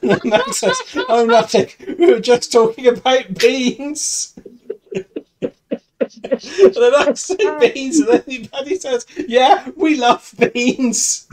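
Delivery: can barely read for laughing. Real, not acted. Host reads the beans script to friends, laughing through it.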